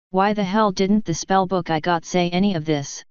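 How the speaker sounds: usual speaking voice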